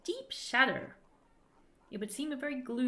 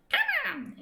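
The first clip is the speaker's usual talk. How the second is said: high, loud squeaky grumble; impatient tiny creature; 5 dB louder than usual. Acted. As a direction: urge them on like an eager sprite. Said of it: "it grumbles impatiently".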